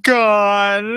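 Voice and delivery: ordinary talk, nothing marked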